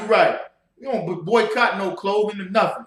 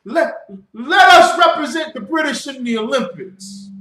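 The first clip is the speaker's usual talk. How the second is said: mocking plea; 8 semitones higher, 8 dB louder than usual. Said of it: "They didn't do it by saying".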